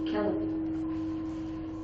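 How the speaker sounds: hushed confession; whispered. Real, not acted. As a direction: drop to a whisper on it. Dark confession of her reaction.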